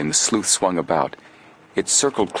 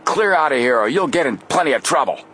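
barked; 7 semitones higher than usual. Acted. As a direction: bark at the intruders. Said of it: "he barked".

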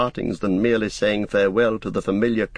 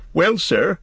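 hesitant, high servant voice; dithering; 10 semitones higher, 4 dB louder than usual. A narrator's reading. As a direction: start nervously, high-pitched. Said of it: "dithering a little".

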